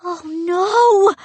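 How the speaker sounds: high, loud anguished wail; a boy's wail of horror. A narrator's reading. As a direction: wail it out in dismay. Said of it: "Sean wailed beside me".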